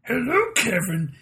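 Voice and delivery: put-on a girl doing Yoda on the phone; a girl answering the phone as Yoda; mock Yoda. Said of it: "girl answering me on the phone like".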